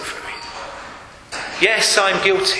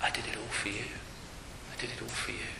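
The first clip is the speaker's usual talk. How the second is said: much softer, tender and intimate; tender hush; 8 semitones lower, 20 dB quieter, faster than usual. Acted. Christ on the cross to us, soft and tender. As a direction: speak as Christ, gently, to each listener. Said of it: "and he says to us".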